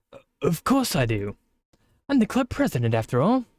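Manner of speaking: lightly voiced British club president; breezy British assurance; British accent. Monika reassuring the player she knows, light British accent. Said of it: "I've given it to you the whole time".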